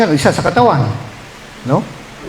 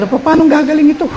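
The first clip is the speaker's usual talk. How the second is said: high anxious question; 9 semitones higher than usual. Retold or imitated. Voicing despairing question.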